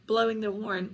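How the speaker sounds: usual speaking voice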